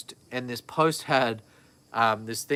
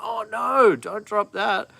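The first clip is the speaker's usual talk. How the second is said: much higher, louder panicked cry; alarmed yell; 9 semitones higher, 5 dB louder, slower than usual. Retold or imitated. Dad reenacting son grabbing the laptop, high panicked yell. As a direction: yelp like the laptop is falling.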